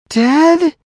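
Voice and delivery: high, strained disbelieving cry that fails; a shriek that comes out a wheeze; shocked wheeze. Acted. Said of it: "intended it to be a shriek, but it came out as a wheeze".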